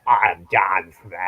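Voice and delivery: low, slow put-on John Smith; lightly voiced fake name intro. Shown as a demonstration. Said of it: "Then I say".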